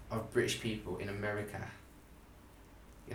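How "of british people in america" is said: ordinary talk, nothing marked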